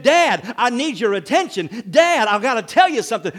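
put-on child pleading to be heard; insistent kid. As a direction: demand dad's attention right now. Child demanding dad's attention.

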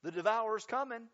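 high gloating cackle, then taunting; mocking enemy. Enemy gloats to the believer: high mocking taunt. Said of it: "the enemy will take every opportunity to say".

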